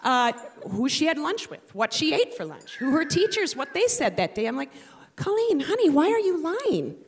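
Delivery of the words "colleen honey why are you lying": high, sing-song worried mother; sweet pleading mom. Retold or imitated. Mother asks her lying daughter, high and pleading. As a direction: coax the truth out gently, pitched up.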